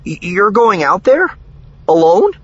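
high, loud, stammered question; startled, stumbling high voice; confused disbelief. A narrator's reading. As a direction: squeak it out, incredulous. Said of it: "his eyes looking confused again".

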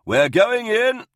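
loud, high, drawn-out call. A narrator's reading. Officer to assembling troops, calling out. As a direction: call it down the whole line of troops. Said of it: "Hart called out".

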